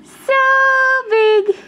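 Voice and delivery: high, loud cheer on big. Real, not acted. Cheering baby's size game.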